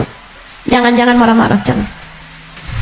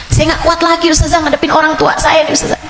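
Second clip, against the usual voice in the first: whiny congregant; 8 semitones higher, faster than usual. Retold or imitated. Congregant complaining to Ustazah.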